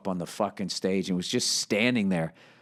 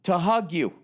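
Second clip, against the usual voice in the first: higher, gushing fan voice; starstruck fan; 9 semitones higher than usual. Retold or imitated. Fan tells the comic his dream, gushing and higher. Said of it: "He goes".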